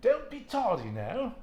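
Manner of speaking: high, slow mock English accent; a hammed-up posh English grandmother scolding. Teacher imitates an English-accented abuela warning a child. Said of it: "I don't always think of an English accent".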